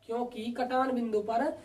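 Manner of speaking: "क्योंकि कटान बिंदु पर" said slowly and softly; slow, quiet dictation. Shown as a demonstration. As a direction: dictate slowly so students can write. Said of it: "देखो मैं बोल रहा हूँ". (धीमे और धीमी आवाज़ में लिखवाना)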